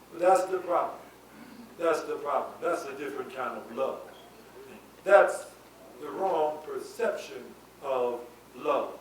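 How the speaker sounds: ordinary delivery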